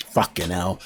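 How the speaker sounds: low, soft muttered curse; imitated quiet exasperation; Gordon Ramsay. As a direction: curse under your breath like a weary chef. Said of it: "and he goes".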